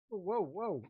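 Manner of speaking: high, mildly alarmed backing-off; a little scared. Acted. Onlookers backing away from lightning, mildly scared. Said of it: "starting to get a little bit scared".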